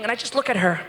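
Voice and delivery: ordinary talk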